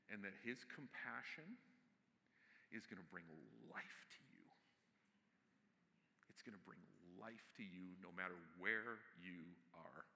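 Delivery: soft, low exhortation with weighty pauses; quiet, intimate sermon close, almost whispered at the softest points; hushed solemn preaching. Real, not acted.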